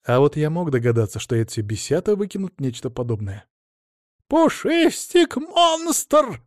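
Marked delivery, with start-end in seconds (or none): 4.3-6.4 high, slow, drawn-out squeal. A narrator's reading. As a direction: squeal it out much higher. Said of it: "взвизгнул Адамыч".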